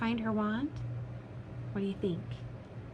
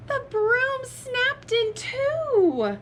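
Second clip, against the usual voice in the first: high-pitched, slow horrified narration; dramatic shock; 14 semitones higher, 4 dB louder, much slower than usual. A narrator's reading. Reader telling children of the broken broom, dramatic shock. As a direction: stretch the disaster out high and slow.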